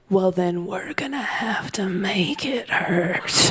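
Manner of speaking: slow, sinister whisper from mom; menacing whisper. Retold or imitated. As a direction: whisper the threat, slow and chilling. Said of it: "in a voice I'd never heard".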